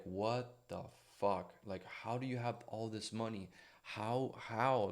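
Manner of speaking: slow, hushed stunned questioning; wondering aloud under the breath; baffled disbelief. Retold or imitated. Walking through a mansion, softly baffled. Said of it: "walk slowly around these houses and be like".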